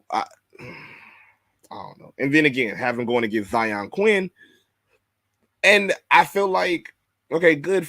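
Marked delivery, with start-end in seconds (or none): 0.1-2.1 slow, breathy, soft I don't know after a sigh; resigned sigh. Real, not acted. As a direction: sigh it out, defeated. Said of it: "sigh of resignation".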